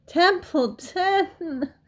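high, long drawn-out wail; stretched, pleading piglet cry; desperate. A narrator's reading. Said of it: "in desperation".

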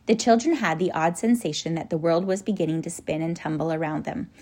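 ordinary delivery

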